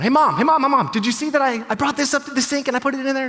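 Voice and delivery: put-on high, fast, eager child calling for mom; excited little kid. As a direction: pipe up like a proud little boy wanting mom's attention. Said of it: "he interrupted the conversation by going".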